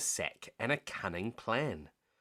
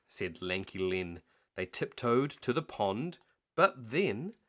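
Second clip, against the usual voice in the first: usual speaking voice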